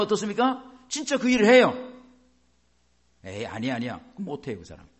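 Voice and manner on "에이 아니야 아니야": low, softer brush-off; lightly acted, waving the idea away; dismissive doubt. Doubter refuses to believe the promise, low dismissive tone.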